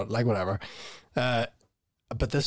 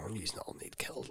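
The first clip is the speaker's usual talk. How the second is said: very quiet, voiceless grumble; muttered threat; 3 semitones lower, 17 dB quieter than usual. Retold or imitated. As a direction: mutter it under your breath. Muttered private threat, retold.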